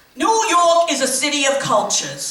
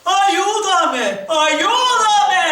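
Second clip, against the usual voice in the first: higher, desperate cry for help; hammed-up horror plea; mock plea; 5 semitones higher, much slower than usual. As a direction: wail it like a talking head in a horror film. Severed head crying for help.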